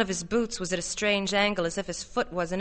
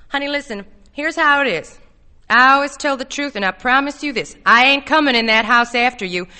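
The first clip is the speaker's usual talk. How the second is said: raised, loud pledge; urgent, higher-pitched persuasion; insistent; 4 semitones higher, 9 dB louder than usual. A narrator's reading. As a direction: press the promise forcefully. Arnold presses over Connie's protests.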